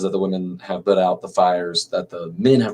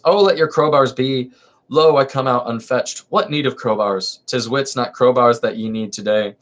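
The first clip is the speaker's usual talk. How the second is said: lively theatrical reading of a verse comedy; dramatic play reading; 4 semitones higher than usual. Acted. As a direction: read the chorus lines with stage energy. Reads Lysistrata.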